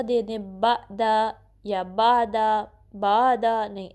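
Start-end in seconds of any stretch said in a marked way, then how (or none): 0.6-1.3 ब, द cut by a glottal catch; ब, stop sharply, then द; wrong hamza jerk. Shown as a demonstration. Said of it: "हमज़ा जैसे बना दें और झटका सा". 1.7-3.4 बा, द with a plain long vowel and no throat sound; wrong missing ayn. Shown as a demonstration. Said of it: "ऐसे नहीं पढ़ना".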